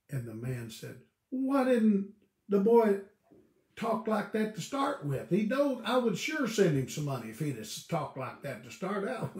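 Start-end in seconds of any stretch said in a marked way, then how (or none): 1.3-9.4 light up at the kind words; a father won over by his son's polite letter; pleasantly surprised father. Retold or imitated.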